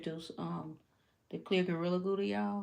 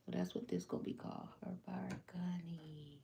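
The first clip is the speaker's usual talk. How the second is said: whispered; 7 dB quieter than usual. Real, not acted. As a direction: breathe the name softly. Names design, whispered.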